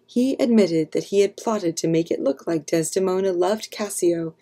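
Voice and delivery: ordinary delivery, nothing marked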